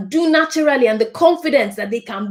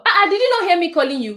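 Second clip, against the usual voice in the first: scolding; 9 semitones higher, faster than usual. Acted. Adult scolding the child.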